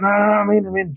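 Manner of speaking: imitating his mate, pitched high; excited shout of a bite. Mate at his rods in the pouring rain, a high thrilled cry. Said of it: "He's like".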